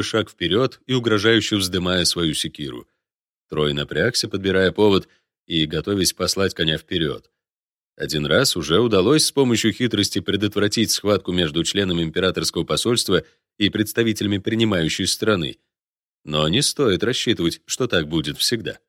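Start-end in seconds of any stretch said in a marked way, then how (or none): none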